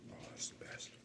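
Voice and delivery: breathy whispered bliss over pizza. Real, not acted. Savoring warm cheesy pizza, whispered to himself. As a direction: whisper it like a delicious secret.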